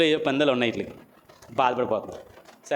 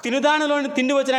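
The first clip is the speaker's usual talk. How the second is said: solemn riddle recitation; 8 semitones higher than usual. Acted. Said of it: "ఏమన్నాడు ఆయన?".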